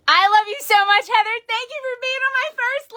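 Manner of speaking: high, loud, heartfelt gratitude; real tearful joy, pitched high; emotional thanks. Real, not acted. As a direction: thank a dear friend on your first live.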